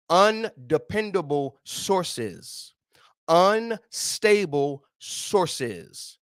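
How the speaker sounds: each source dragged out, very slow; slow emphatic preaching. Acted. Preacher warning the congregation, very slow and emphatic. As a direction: spell out every word for the congregation.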